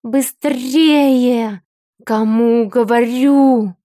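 loud, slow orc growl. Acted. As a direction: bark it like an impatient orc. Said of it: "рычал орк".

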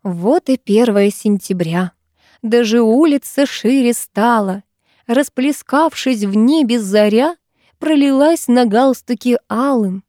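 slow, lilting poetry recital; ceremonious teacher reciting verse; solemn declamation. A narrator's reading. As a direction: declaim it grandly, stretching each line. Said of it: "начала торжественно декламировать".

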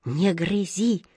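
slow, urgent plea; agitated voice. A narrator's reading. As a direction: beg it slowly, drawing out the words. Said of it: "послышался чей-то взволнованный голос".

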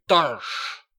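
loud, high, ferocious shout; fierce cry. A narrator's reading. Tyrant king fiercely mocking the dwarf's word. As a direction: bark the word back at him. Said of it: "cria le tyran férocement".

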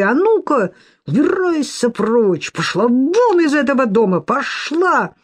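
high, furious crying order. A narrator's reading. Lidia throws Sofya out, furious and tearful. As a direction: drive her out of the house.

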